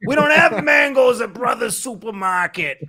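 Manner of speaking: hammed-up furious store clerk; angry clerk shout. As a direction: yell it like a fed-up store clerk. Acting out a surly store clerk.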